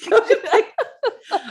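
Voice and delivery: high, slow and giggling; cracking up through the words; laughing. Real, not acted. Echoing the joke, laughing.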